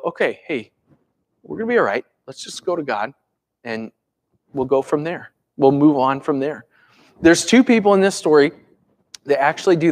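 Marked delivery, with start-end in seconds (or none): 0.0-6.7 calm reassurance. Retold or imitated. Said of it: "just look at it and go".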